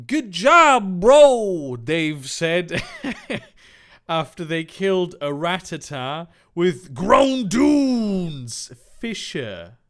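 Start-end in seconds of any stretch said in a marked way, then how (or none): 0.1-1.8 higher, loud cheering character voice; hammed-up Dave. Acting Dave's line in fiction. 6.9-9.8 the name suddenly bellowed and stretched; all-caps shout. Acted.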